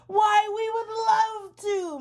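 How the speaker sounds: high, slow, delighted snail. Acted. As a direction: savor the invitation slowly. Snail accepts invitation.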